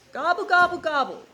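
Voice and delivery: loud, high gobbling; turkey gobble. Acted. Teacher gobbling as the turkey for the class.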